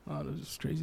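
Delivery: ordinary talk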